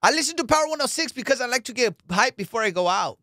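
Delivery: put-on high, loud girl caller voice; phone in hyped for Friday night; hyped radio caller. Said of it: "they would call into".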